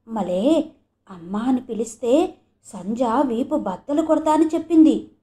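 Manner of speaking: plain reading voice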